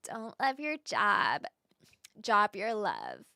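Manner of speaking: whispery, unvoiced Kardashian-style voice; soft, airy celebrity-voice mimicry; Kardashian impression. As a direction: breathe it out like a Kardashian slogan. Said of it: "let me do this for you".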